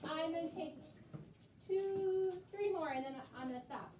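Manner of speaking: ordinary talk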